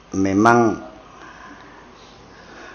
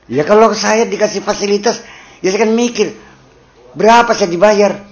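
mock greedy worker; 9 semitones higher, 7 dB louder than usual. Mocking a pampered, paid worker.

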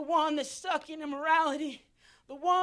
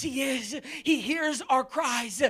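usual speaking voice

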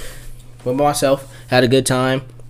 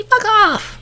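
very high mock female voice; mimicked woman's brush-off. Woman tells off a man at a club, mock-annoyed falsetto.

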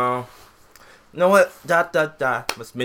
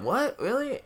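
high, rising "what? really?"; incredulous; 14 semitones higher, 3 dB quieter than usual. Retold or imitated. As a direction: disbelief climbing up.

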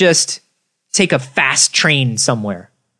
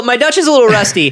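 put-on Dutch accent; 7 semitones higher, faster than usual. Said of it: "This is the worst Dutch accent".

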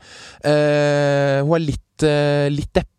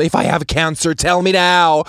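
loud dramatic English plea, rising; full show; 7 semitones higher, 4 dB louder, slower than usual. Retold or imitated. Patient demanding the doctor in English, dramatic plea. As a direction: demand it theatrically, louder at the end. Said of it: "jeg kjører fullt show".

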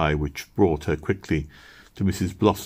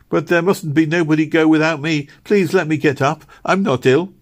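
high, louder insistent pleading; insistent child; 9 semitones higher, 5 dB louder than usual. A narrator's reading. Meg insists nobody goes without her, insistent pleading. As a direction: refuse to be left behind.

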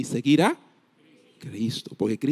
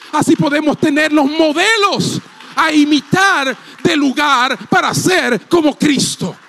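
prédica enérgica; 13 semitones higher, 5 dB louder than usual. Real, not acted. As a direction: preach it at full force, pitched high above normal talk. Preaches at full force. (predicación enérgica, voz alta y aguda)

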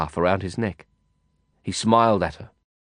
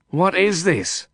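slow, pointed demand; 8 semitones higher, slower than usual. Acted. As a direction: demand an answer, slowly. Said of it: "I demanded".

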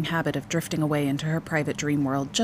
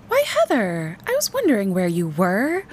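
higher, put-on cheerful mother voice; infused enthusiasm; 4 semitones higher, 4 dB louder than usual. A narrator's reading. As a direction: greet a small child with overdone enthusiasm. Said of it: "infusing her voice with enthusiasm again".

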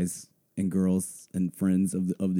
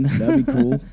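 mock wistful; 14 semitones higher, 5 dB louder than usual. Wistful joke about having fun.

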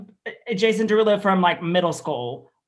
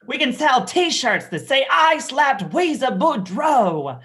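loud, high, swooping Southern proclamation; film character quote; 10 semitones higher, 7 dB louder, slower than usual. Acted. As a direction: belt it out like a hammed-up movie line. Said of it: "And I'm like".

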